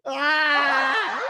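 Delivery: high pained wail; anguished cry. Real, not acted. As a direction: cry out over losing a favorite player. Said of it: "I'm sad about that".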